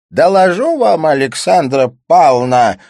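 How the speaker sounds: loud, high, weighty pronouncement; slow declamatory opening; slow, deliberate. A narrator's reading. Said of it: "медленно промолвил Пегасов".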